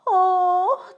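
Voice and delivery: high, loud short cry; child crying out after a fall; cry of pain. A narrator's reading. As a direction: yelp as she falls down. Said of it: "diye bağırdı Francesca".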